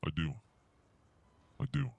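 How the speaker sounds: normal talking voice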